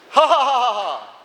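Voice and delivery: loud burst of ha ha then fading; demo mocking laugh. Shown as a demonstration. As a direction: laugh out at them, then let it drop off. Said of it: "let's try once".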